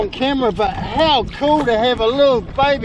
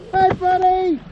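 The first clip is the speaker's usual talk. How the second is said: higher friendly call; 7 semitones higher than usual. Real, not acted. Kayaker calls to the baby whale, friendly call. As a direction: hail the whale cheerfully. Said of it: "calling out to him".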